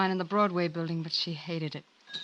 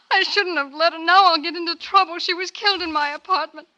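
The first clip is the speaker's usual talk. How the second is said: higher and louder, fear building; frightened girl pleads with the detective, rising panic; 10 semitones higher, 6 dB louder than usual. Acted. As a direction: she spirals into panic. Frightened girl fearing blame.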